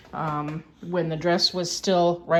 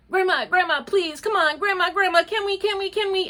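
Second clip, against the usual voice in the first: imitating nagging grandkids; 9 semitones higher than usual. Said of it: "when my other grandkids nag me".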